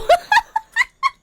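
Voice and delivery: helpless squealing laugh; high burst of laughter. Real, not acted. Host reading a listener story cracks up laughing. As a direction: break into a high giggle fit.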